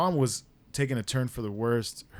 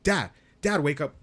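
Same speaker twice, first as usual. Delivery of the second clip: high, loud retold call; acted alarm, pitched up at the start; urgent calling; 4 semitones higher, 5 dB louder than usual. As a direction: call out to rouse someone asleep. Acted attempt to rouse dad.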